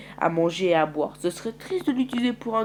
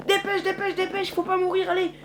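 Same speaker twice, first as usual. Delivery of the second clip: high, urgent hurry-up calls; frantic urging; 13 semitones higher than usual. Acted. Urging the group to flee, frantic and high. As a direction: push them to run for their lives.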